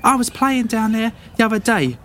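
put-on very high, brave-faced little boy shouting; a nervous kid puffing himself up against strangers; scrappy farm boy. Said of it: "He's like".

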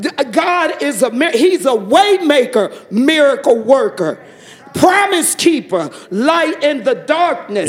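high, ringing church proclamation; exultant praise. Real, not acted. Praising God as way maker to fellow believers, exultantly. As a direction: call out each title with real fervor.

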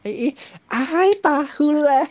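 high, slow, far-off gossip voice; high gossiping woman. Retold or imitated. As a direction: squeal it like a chatty auntie. Mimicking women's gossip. (suara ibu-ibu bergosip, tinggi dan lambat)

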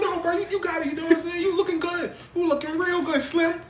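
high-pitched, hurried-sounding appeasement; comic scared flattery; mock nervous placating. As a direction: back down in a squeaky voice. Acts out backing down, flattering.

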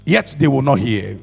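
slow, solemn reading of scripture. Acted. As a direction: read it slowly like holy writ. Rereading scripture slowly.

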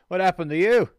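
cheeky rogue calling out; character voice. Acted. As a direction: blurt it at a nasty-looking stranger. Player voices character greeting a stranger.

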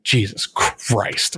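slow, drawn-out exasperated oath; mock exasperation. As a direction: imitate giving up on someone, stretching each word. Retold exasperation at wrong answer.

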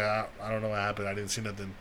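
retold feigned ignorance. Retold denial to the police.

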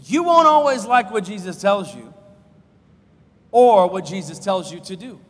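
loud, driving pulpit voice; fervent sermon delivery; preaching at full force. Acted. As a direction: press the warning on the congregation. Preacher repeats the hard point.

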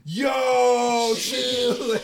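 loud, high yell with a crack of laughter; shocked shout. Retold or imitated. Reacting to her offer with a shocked shout. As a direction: shout it out in disbelief. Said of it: "And I'm just like".

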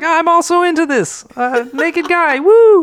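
high, loud, ending in a whoop; excited whoop. Acted. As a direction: cheer it up to a shrieking woo. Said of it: "she's like".

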